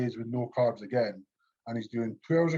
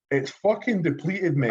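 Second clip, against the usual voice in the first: exasperated retort; 4 semitones higher, 4 dB louder than usual. Retold or imitated. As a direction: argue back at the coach in disbelief. Retold exasperated reply to coach.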